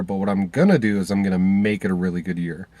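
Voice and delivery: ordinary talk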